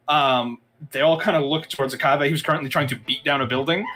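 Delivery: usual speaking voice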